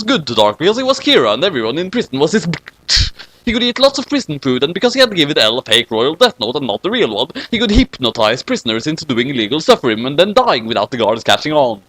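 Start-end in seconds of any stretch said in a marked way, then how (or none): none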